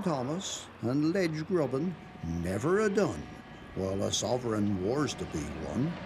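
ordinary delivery

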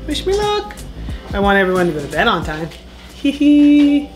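normal talking voice

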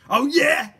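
loud, high mocking challenge; sneering. A narrator's reading. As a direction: sneer back at the girl. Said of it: "sneered Mister Gum".

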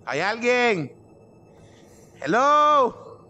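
high calling into the dark; nervous calling out. Real, not acted. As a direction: call out, half scared, into an empty house. (llamando en voz alta, nervioso)

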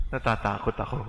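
soft, quiet confession of fear; a soft, honest natatakot ako; quiet admission. Shown as a demonstration. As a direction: say it softly, owning the fear. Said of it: "Ang dapat mo lang gawin ay sabihing".

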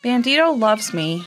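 usual speaking voice

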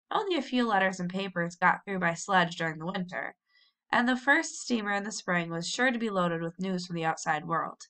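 ordinary delivery, nothing marked

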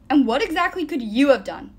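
loud, high angry retort; heated comeback; shooting back. A narrator's reading. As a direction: cut in with a sharp challenge. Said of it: "Nico shot back".

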